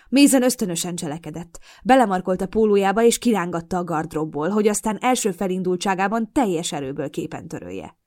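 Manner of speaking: ordinary delivery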